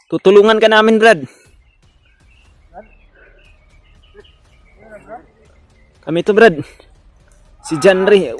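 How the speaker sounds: loud pleading call; shouting pleas. Real, not acted. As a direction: shout to reach him. Pleading loudly with possessed friend.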